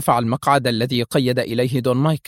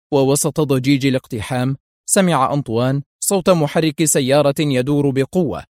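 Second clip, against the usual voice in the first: ordinary delivery, nothing marked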